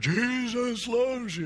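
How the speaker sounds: high, slow, booming mock megaphone; imitated megaphone yell. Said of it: "it acted like a megaphone".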